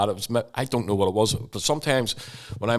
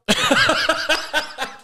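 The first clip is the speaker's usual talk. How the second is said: a short burst of high laughter. Real, not acted. After the sun cream joke, high laughter.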